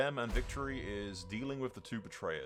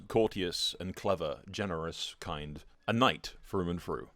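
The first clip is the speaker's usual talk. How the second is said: measured, solemn storyteller delivery; grand fantasy prologue narrator; epic story narration; 4 semitones lower, 3 dB louder than usual. A narrator's reading. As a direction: recount it like a chronicle of war.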